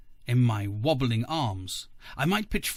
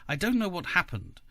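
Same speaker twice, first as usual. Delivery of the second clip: ordinary delivery, nothing marked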